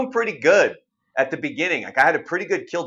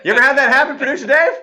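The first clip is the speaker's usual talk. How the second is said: high, loud laughing question; 12 semitones higher, 10 dB louder, faster than usual. Real, not acted. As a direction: ask through real laughter. Laughing, asks the producer.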